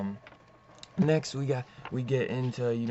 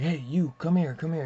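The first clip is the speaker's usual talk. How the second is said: retold shout after someone, higher and a bit louder; half-shouted, raised-pitch summons; mock calling out; 5 semitones higher, 4 dB louder than usual. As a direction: imitate a mobster hailing someone he's chasing. Acted mobster calling after someone.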